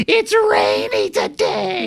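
very high, slow, drawn-out whine; mock whining. Host mimicking San Diego's rain gripes, high mock whine. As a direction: stretch it into a squeaky mock complaint.

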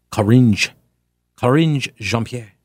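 Karine said with the end of cringe, ah then in-zh; Karine rhymed with cringe. Shown as a demonstration. Host correcting himself on Karine, ending like cringe. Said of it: "I reframe the first name as cringe".

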